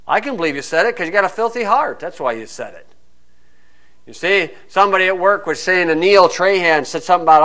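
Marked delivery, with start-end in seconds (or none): none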